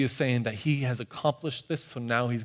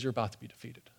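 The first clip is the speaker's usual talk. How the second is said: suddenly soft and grave; quietly delivered blow; hushed hard news; 3 semitones lower, 12 dB quieter, faster than usual. Retold or imitated. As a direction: drop the voice to break bad news. Voices Jesus breaking hard news.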